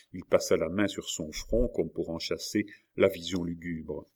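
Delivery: ordinary delivery, nothing marked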